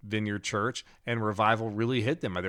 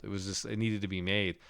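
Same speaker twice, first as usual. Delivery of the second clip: ordinary talk, nothing marked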